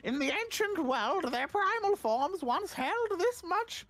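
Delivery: high, slow, grand character voice; put-on cool voice, drawn out and high; cool serious voice. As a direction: intone it slowly like ancient lore, pitched well up. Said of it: "I'll give him a cool voice".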